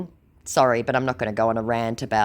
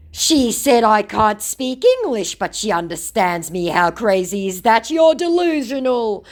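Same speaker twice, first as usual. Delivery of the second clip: louder, higher, slowed-down mock scammer; mock scammer retort; 7 semitones higher, 5 dB louder than usual. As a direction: fire back as the smug scammer, hammed up. Mocking the scammer's reply.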